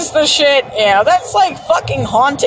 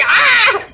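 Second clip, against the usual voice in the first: mock yelp; 10 semitones higher than usual. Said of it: "I was like".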